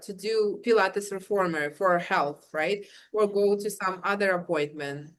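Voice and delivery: ordinary talk, nothing marked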